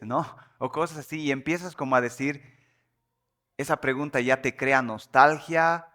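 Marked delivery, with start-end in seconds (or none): none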